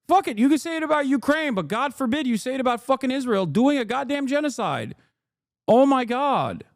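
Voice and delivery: high, fast outburst of indignation; fed up and incredulous; heated exasperation. Real, not acted. Heated rant about double standards.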